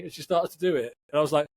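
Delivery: ordinary talk, nothing marked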